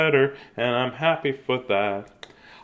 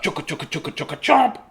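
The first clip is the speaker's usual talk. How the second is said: chugga chant; 5 semitones higher, faster than usual. Acted. Eating sound effect chant.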